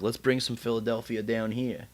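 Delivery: mock mob-like businessman. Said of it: "Real mob-like".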